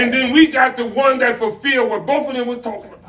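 energetic, rhythmic sermon line; full-voiced preaching. Pastor building to Christ fulfilling the law, preaching. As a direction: drive to the point like a preacher.

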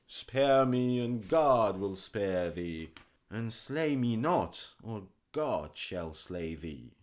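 beg, then sink into despair; pleading physician. Acted. Weeping physician begging the king, loud then fading.

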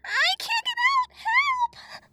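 very high, loud, crying shriek; hysterical fairy. A narrator's reading. Trapped fairy screaming to be rescued, hysterical. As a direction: scream in mortal terror as a tiny fairy. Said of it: "The fairy's voice was hysterical".

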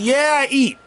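irritated comeback; indignant retort. Real, not acted. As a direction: fire back high and offended.

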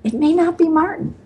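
a hint of the sister pressing her to pick up; imitating her sister. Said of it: "And she said".

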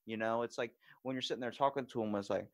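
ordinary talk

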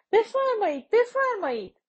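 a high, loud welcoming call; eager welcome. A narrator's reading. Housekeeper welcoming the two women inside, high and eager. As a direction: usher them in warmly.

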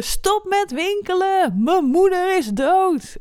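hoog en traag, halve schreeuw uit wanhoop; imagined scream. Retold or imitated. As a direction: roep het hoog en langzaam uit alsof je de hele supermarkt wilt stilzetten. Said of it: "ik wilde echt alleen maar schreeuwen". (zij schreeuwt in gedachten tegen de winkelende mensen, hoog en gerekt)

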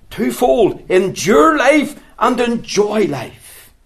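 deliberate pulpit emphasis; emphatic slow summary. Acted. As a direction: spell out the twofold creed.

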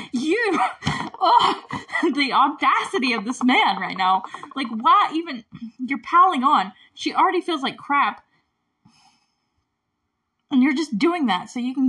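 worked up and scandalized; real outrage. Real, not acted. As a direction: let the outrage burst out. Outraged rant at film villain.

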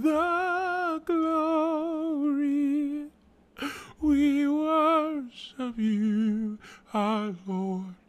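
very high, very slow, drawn-out praise; transcendent worship leader. Retold or imitated. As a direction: float each word like a hymn. Mimicking worship leader again.

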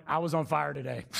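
lightly voiced cocky quarterback; a hint of a boastful star athlete; Tom Brady bragging. Imagined athlete bragging on TV, mild mock swagger. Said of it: "hear Tom Brady going".

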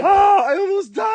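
high, loud mock terrified cry; mock fright. Host imitating his friend's fright, high loud mock cry. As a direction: yelp like you nearly died. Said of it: "You go".

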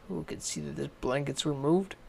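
ordinary delivery, nothing marked

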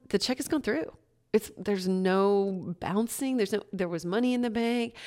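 normal talking voice

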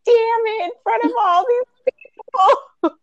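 high, teary mock self-curse; half-laughing at her own tears; woman mocks herself crying in front of coworkers, teary; retold crying frustration. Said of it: "just sitting there crying".

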